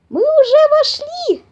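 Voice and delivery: loud, very high, slow mouse yell; high shout. A narrator's reading. Forest mouse telling papa bear they are inside, shouting. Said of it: "закричал Мортон".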